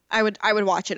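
normal talking voice

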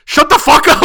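high, loud mock outburst; pretend yelling. Fed-up moviegoer to noisy kid, high pretend yell. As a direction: snap it loudly, as if scolding a noisy kid. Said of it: "I can't turn around and yell at this".

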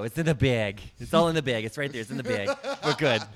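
hammed-up New York swagger; imitating the coworker while laughing; New York impersonation. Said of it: "impersonation of him".

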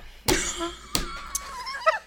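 high shrieking burst of laughter. Real, not acted. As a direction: let out a squealing laugh. Laughing fit at her own joke.

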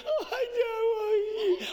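hammed-up sob of a crushed kid; imitating a child crumbling into tears; mock crying child. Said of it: "they crumble".

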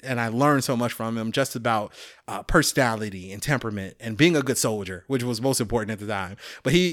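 ordinary delivery

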